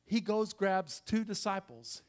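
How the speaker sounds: ordinary talk, nothing marked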